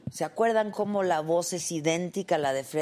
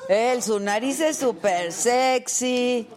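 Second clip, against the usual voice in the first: swooning over his nose, drawn out; 6 semitones higher, 5 dB louder, slower than usual. Acted. Host gushing to the audience about the actor, swooning. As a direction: gush with slow, loud delight. Repeats guest's swooning line.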